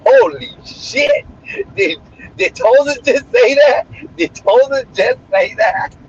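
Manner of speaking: high, slow stunned cry; reliving a shocked laugh at the bot; shocked disbelief. Retold or imitated. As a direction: gasp it out in disbelief. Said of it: "I was like".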